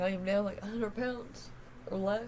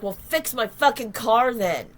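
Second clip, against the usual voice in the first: retold angry retort; 10 dB louder, slower than usual. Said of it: "I'm like".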